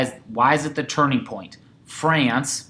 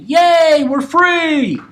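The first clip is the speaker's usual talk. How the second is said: much higher, loud hammed-up yay; mock cheer; 9 dB louder, much slower than usual. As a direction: cheer like the freed colonists, way over the top. Cheering colonists in class.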